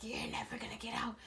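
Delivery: eerie unvoiced whisper; sinister dream voice. Mysterious voice taunting the trapped dreamer, sinister whisper. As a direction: whisper it as a menacing phantom voice. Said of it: "So the voice like".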